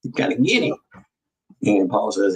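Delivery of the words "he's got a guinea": much higher, lightly imitating Maggie; high mock Maggie voice. Retelling Maggie's line on the tape in a high mock voice. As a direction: pitch up to mimic the woman on the video. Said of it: "That's what she said".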